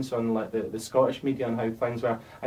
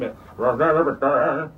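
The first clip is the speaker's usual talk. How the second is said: garbled, drawn-out American singer's twang, louder; mock American accent; 2 semitones higher, 6 dB louder than usual. Rapper mocking a Scottish singer's fake American accent. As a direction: parody a put-on American accent. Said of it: "where's that voice coming from".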